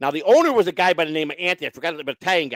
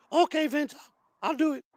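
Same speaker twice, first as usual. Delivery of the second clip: high put-on voice, giving in; High Pitch Eric; 12 semitones higher than usual. Man giving in to the lawyer, high-pitched impersonation. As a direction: agree meekly in a very high voice. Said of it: "really good high pitch Eric impersonation".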